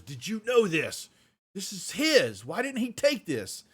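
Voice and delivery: high, loud angry accusation; angry Reed. Acted. Reed confronting Flynn over the body, angry and high. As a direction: confront him, furious at the betrayal. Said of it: "Reed gets really pissed".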